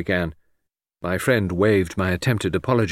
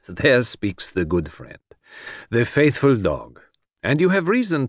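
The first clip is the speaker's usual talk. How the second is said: Poirot, fond then adamant; 4 semitones higher than usual. Acted. Detective tells faithful friend he has retired, fondly adamant. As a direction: praise fondly, then insist it is over.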